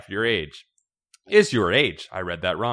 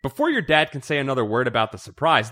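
plain reading voice

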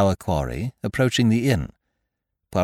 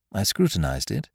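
usual speaking voice

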